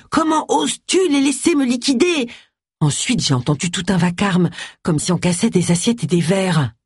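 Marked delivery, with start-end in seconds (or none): 0.1-2.3 high-pitched mock yell of outrage; imitated yell. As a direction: quote a furious man, pitch raised. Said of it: "Il hurlait".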